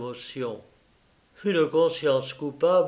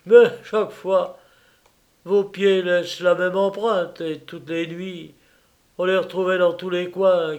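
loud, raised, gloating threat; angry millionaire; 5 semitones higher, 7 dB louder than usual. Acted. Rosenthal gloats loudly at the trapped burglar. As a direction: lord it over the caught thief.

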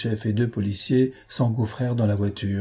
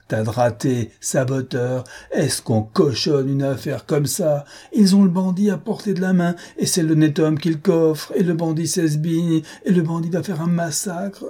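angry tirade rising in pitch; mounting fury; 7 semitones higher than usual. A narrator's reading. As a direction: berate the bungling police, building as it goes. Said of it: "et sa fureur redoubla".

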